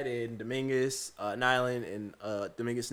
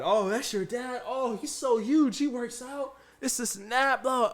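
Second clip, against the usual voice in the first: mock fawning; 9 semitones higher than usual. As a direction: imitate coworkers laying on the flattery. Coworkers fawn over his dad.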